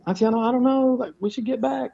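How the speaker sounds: a companion nervously trying to back out; nervous hesitation. Retold or imitated. Hesitant companion wanting to leave.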